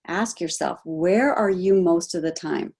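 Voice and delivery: ordinary talk, nothing marked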